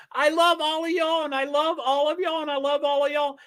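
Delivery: high, sing-song repeated "I love all of y'all"; mock gushing streamer. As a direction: parody a syrupy streamer. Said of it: "you know how some unnamed people say".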